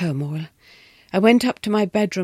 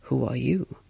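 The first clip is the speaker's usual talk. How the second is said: low questioning wife; 7 semitones lower than usual. A narrator's reading. The wife turning the question on the governess, low.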